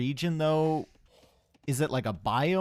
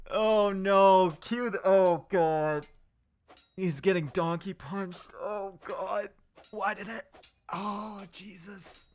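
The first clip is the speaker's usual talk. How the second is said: shocked dismay; 6 semitones higher, slower than usual. Real, not acted. Reacting to the kill.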